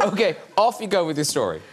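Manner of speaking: usual speaking voice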